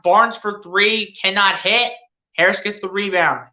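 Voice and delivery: low, easy running commentary; lightly voiced play-by-play. Fan calling his team's game for his viewers, mild play-by-play. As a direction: call the passes like a broadcaster, understated.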